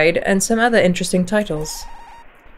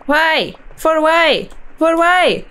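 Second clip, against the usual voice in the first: indignant whine; 8 semitones higher, much slower than usual. Real, not acted. Demanding context from game.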